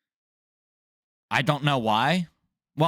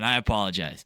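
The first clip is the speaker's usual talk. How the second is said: normal talking voice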